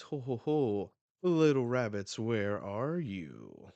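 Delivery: loud, sing-out hunter's call, slowing at the end; hammed-up villain taunt. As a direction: call out to your prey. Voicing hunter taunting rabbits.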